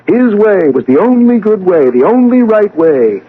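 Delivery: high, loud sneer at the father's way; bitter mockery. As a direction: scorn it, voice climbing.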